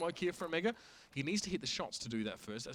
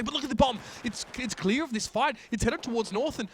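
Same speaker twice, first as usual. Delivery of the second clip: higher and louder, urgent play-by-play; rising urgency as the bomb is missed; excited commentary; 7 semitones higher, 5 dB louder than usual. Real, not acted. Caster alerting the co-caster to a loose bomb, excited.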